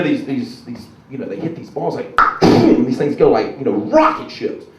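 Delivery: ordinary delivery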